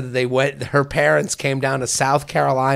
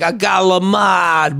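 higher, drawn-out shout of "galamad"; Italian-American; 4 semitones higher, slower than usual. Acted. Podcaster shows harmless-sounding Italian bit, Italian-American accent. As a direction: holler it slow, mock Italian-American. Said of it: "when you're like".

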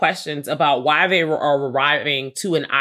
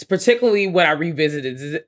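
normal talking voice

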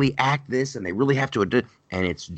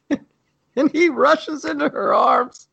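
laughing; 4 dB louder, slower than usual. Real, not acted. Mocking tearful reunion scene.

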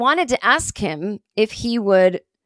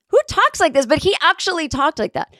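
incredulous; 9 semitones higher than usual. Real, not acted. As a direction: ask in amused disbelief. Incredulous aside about Norm's speech.

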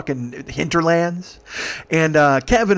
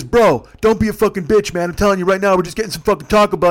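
loud, higher-pitched mock scolding; acted quarrel; 5 semitones higher, 5 dB louder than usual. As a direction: lay into your buddy, acted out. Two drunk friends quarrel in car.

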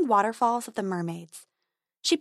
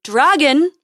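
loud higher call; 6 semitones higher, 10 dB louder than usual. Acted. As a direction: shout the name into the dark. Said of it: "he called again".

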